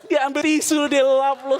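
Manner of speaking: high, loud incredulous punchline; excited punchline. Real, not acted. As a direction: deliver the letdown big and loud. Punchline of tissue story.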